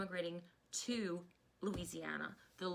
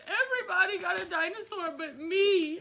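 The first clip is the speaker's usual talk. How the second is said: put-on high, louder little-girl complaint; imitating daughter; 8 semitones higher, 8 dB louder than usual. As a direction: whine like a left-out kindergartner. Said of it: "She's like".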